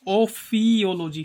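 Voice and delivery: ओफियोलॉजी slowly split as ओ-फी-ओलॉजी, long फी; slow breakdown. Shown as a demonstration. Teacher setting up the snake-hiss trick, ओफियोलॉजी slowly split at फी.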